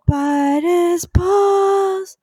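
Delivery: drawn-out grand proclamation; slow dramatic. Acted. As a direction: stretch every word grandly.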